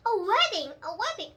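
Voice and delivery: delighted. A narrator's reading. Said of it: "really delighted".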